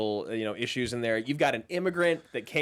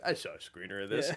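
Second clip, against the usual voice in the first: lower, softer, quick smug aside; 6 semitones lower, 6 dB quieter, faster than usual. Retold or imitated. Moviegoer who already knows the twist, smug aside. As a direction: mutter it smugly to yourself. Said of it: "kind of be like".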